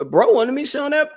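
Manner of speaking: ordinary talk, nothing marked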